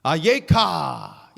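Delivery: 예카 said slowly and loudly, stretched out; Hebrew word. Shown as a demonstration. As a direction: give 예카 a slow, ringing Hebrew cry. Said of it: "이게 히브리어로요".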